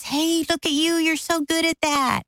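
gushy high-pitched encouragement; cheering. Retold or imitated. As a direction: imitate a parent cheering on a kid. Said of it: "they're just cheering for us".

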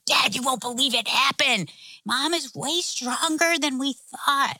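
put-on high-pitched kids reporting big news; amazed child voice, stretching the key words; excited boys. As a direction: imitate boys bursting to tell their father. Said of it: "All three boys are instantly like".

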